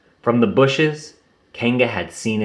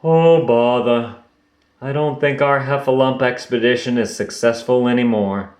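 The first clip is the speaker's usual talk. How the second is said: Pooh sighing. Acted. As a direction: let out a sad little bother. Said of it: "Pooh sighed".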